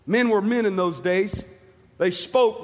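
ordinary delivery

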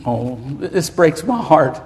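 low, soft and slowed, choked with feeling; real grief breaking through the preaching; emotional, heart breaking. Real, not acted. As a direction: let the voice sink as it catches in the throat. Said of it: "I am emotional".

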